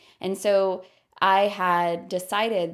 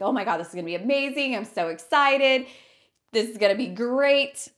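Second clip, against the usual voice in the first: gushing, building higher and louder; excited; 5 semitones higher, 5 dB louder than usual. Retold or imitated. Telling a friend about the big trip, excited. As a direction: burst with eager delight.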